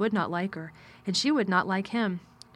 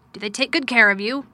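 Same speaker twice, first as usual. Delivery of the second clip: louder curt master asking; gruff man voice; 3 semitones higher, 8 dB louder than usual. Acted. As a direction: ask it with cool distance. Mr Craven speaks.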